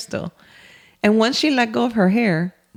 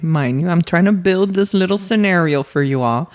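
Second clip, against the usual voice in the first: ordinary delivery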